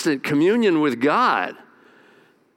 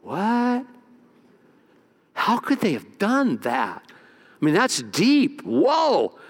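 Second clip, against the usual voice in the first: mock shocked reaction; 5 semitones higher, much slower than usual. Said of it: "and you go".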